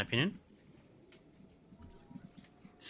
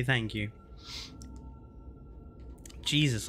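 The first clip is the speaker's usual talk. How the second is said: ordinary talk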